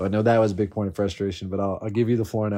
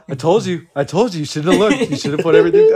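higher, louder I-told-you; gloating friend; 6 semitones higher, 7 dB louder than usual. Retold or imitated. Friend gloating after the block, loud taunt. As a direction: rub it in smugly.

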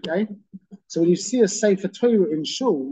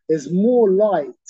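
more punched louder and slowed; slow down and hit more hard; loud, slow, heavy stress on more; 3 semitones higher, 6 dB louder, much slower than usual. Shown as a demonstration. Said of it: "emphasis on the word more".

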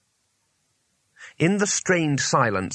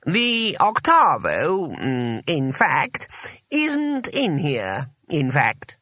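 high, halting, drawn-out admission; slow, hesitant wizard voice; 4 semitones higher, much slower than usual. A narrator's reading. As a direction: pick through each phrase slowly. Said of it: "said the voice slowly".

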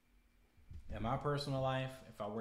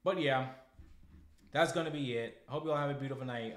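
louder, higher, fast goodbye; host signing off to his viewers, upbeat; cheerful sign-off; 3 semitones higher, 6 dB louder than usual. Real, not acted. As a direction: wrap it up with a bright send-off. Signing off the episode.

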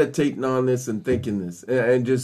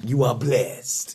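put-on playful Italian accent, lower and slower; 4 semitones lower, 4 dB quieter, slower than usual. Preacher joking while blessing a listener, playful Italian accent. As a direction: say it slowly as a mock Italian. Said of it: "in an Italian accent".

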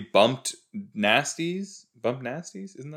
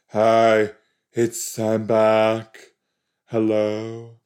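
character impression; 5 dB louder, much slower than usual. Imitating a returning character.

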